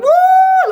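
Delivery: very high, loud, sustained "woo"; long piercing mock whoosh; soaring engine whoop. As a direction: mimic a bike flying off a ramp. Imitating the bike ramping.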